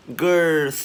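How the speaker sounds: "Girth" loud and high, long airy TH ending; emphatic teacher's model of "Girth"; loud released TH. Shown as a demonstration. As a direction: project "Girth" and push out the last sound. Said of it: "bật càng to thì càng hay".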